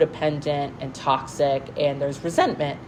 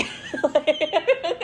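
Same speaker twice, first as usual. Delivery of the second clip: laugh; 13 semitones higher than usual. Real, not acted. Laughs after fan impression.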